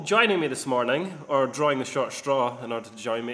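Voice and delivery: ordinary delivery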